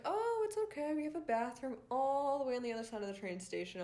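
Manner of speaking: high-pitched put-on helper voice; singsong mock reassurance, starting very high; mock helpful station guy. Acts out station guy offering directions.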